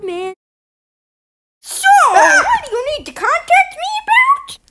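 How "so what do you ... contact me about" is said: much higher, laughing shout. Acted. Leader asking why he was contacted, high laugh. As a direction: squeal it with a laugh.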